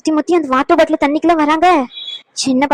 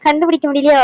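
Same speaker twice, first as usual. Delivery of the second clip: normal talking voice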